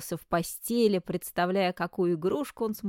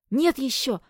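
high, loud eager answer; excited; 7 semitones higher, 6 dB louder than usual. Acted. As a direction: blurt it out eagerly. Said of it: "возбуждённо ответил Клеон".

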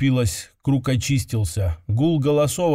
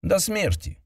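curt; 7 semitones higher, 3 dB quieter than usual. Acted. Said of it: "коротко сказала она".

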